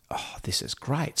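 slow, whispered aside to himself; hushed delight. Retold or imitated. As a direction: whisper it as a retold private reaction. Retold private reaction on hearing himself.

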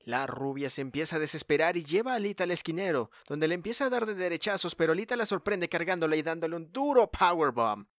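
excited play-by-play rising to near-shouting; wrestling commentator. Acted. Live play-by-play building to climax.

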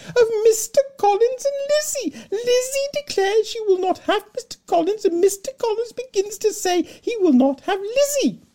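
very high, loud, fluttering matron voice; fussy Mrs Bennet. Acted.

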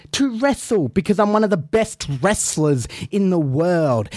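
ordinary talk, nothing marked

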